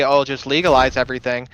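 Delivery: ordinary talk, nothing marked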